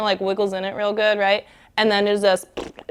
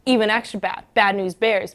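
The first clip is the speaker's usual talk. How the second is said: usual speaking voice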